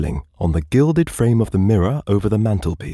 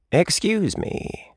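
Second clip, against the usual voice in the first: talking cat; 12 semitones higher, much slower than usual. Acted. Cat answers from the mirror.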